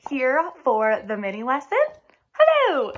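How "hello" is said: high, loud cheery greeting; bright hello. Real, not acted. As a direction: sing out a big hello to the class. Teacher greeting class brightly.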